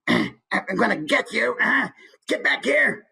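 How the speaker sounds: high, slow villain threat through a scrunched face; scrunched-up character voice. Shown as a demonstration. Teacher shows how posture anchors characters, scrunched-up character voice. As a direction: menace with the whole face screwed up. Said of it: "scrunched up face, like".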